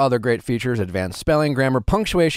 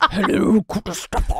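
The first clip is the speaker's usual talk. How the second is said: high mock-British greeting; put-on British hello, pitched high; obnoxious bad accent; 7 semitones higher than usual. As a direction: ham up an awful British accent. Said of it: "start really obnoxious bad accent".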